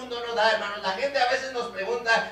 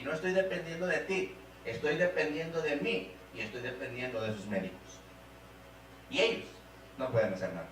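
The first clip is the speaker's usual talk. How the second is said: halting, hushed confession with long pauses; retold prayer, sinking very low and soft; surrendering prayer; 3 semitones lower, 5 dB quieter than usual. Father giving his sick daughter over to God, sorrowful surrender.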